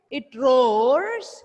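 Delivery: loud, stretched-out roars, rising; loud repeat-after-me. Shown as a demonstration. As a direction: project and draw out the roar word. Model phrase for pupils to repeat.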